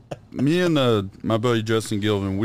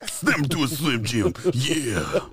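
slow raspy wrestler drawl; Macho Man voice; 3 semitones higher, much slower than usual. Acted. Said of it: "I want you to be Macho Man".

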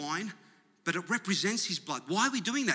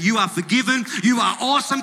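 loud, rising proclamation; crying out; 4 semitones higher, 9 dB louder than usual. Acted. Blood of Jesus affirming the believer, crying out loudly. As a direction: cry it out, building in pitch. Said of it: "the blood that is crying out".

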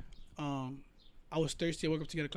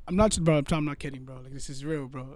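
ordinary talk, nothing marked

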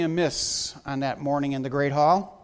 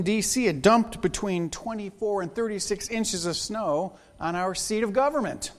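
ordinary talk, nothing marked

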